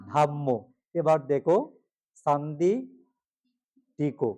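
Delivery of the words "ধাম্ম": ধাম্ম with a long dha and a doubled m; correct pronunciation. Shown as a demonstration. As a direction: model ধাম্ম slowly. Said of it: "কি হবে?". (সঠিক উচ্চারণ ধাম্ম)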